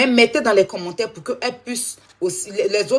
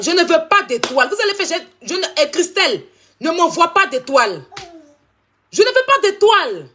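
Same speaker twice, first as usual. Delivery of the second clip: much higher and louder, insisting over and over; shouting; 9 semitones higher, 4 dB louder than usual. Real, not acted. Streamer yelling at viewers not to send stars, real shouting. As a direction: shout it high and loud at the chat. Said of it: "désolée si j'ai crié".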